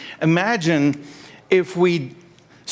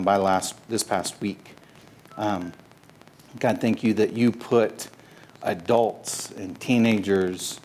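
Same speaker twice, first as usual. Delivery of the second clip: prayer; 3 semitones lower than usual. Real, not acted. Leads a prayer.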